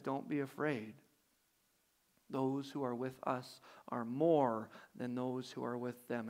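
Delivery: soft, low and calm, a touch of comfort; reassuring. A narrator's reading. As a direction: quietly reassure a frightened servant. Elisha calms his servant.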